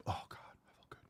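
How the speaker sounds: whisper. Real, not acted. After coughing, drink.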